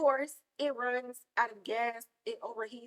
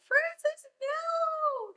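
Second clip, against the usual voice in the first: high, loud scream of warning; screaming at the screen; 9 dB louder, much slower than usual. Retold or imitated. Viewer warns Francis of the monster, high loud scream. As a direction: shriek his name as the monster comes. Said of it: "And I'm just like".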